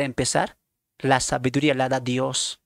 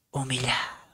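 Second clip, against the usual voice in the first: hushed whisper on the last word; whispered ending; 3 semitones lower, 7 dB quieter than usual. Acted. Preacher closing the psalm quote, soft whisper. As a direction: breathe the word out.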